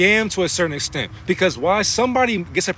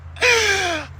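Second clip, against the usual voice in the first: sharp high gasp; 12 semitones higher than usual. Real, not acted. Gasp after mantra.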